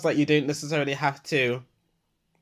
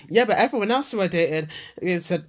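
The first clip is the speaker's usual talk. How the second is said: ordinary talk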